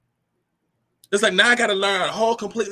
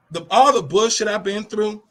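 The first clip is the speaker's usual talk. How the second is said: ordinary talk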